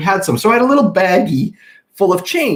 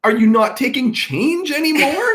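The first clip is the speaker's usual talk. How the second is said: indignant; 8 semitones higher than usual. Retold or imitated. Retold indignant questions to teller.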